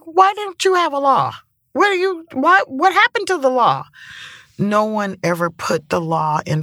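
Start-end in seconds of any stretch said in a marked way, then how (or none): 0.0-3.8 incredulous outrage. Retold or imitated. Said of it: "And I'm like".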